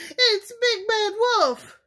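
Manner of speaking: a startled mother goose shrieking; frightened cry. A narrator's reading. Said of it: "cried Missus Goose".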